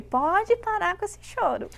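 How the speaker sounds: strict mother. Retold or imitated. Mother scolds her crying child, slow and high. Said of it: "minha mãe".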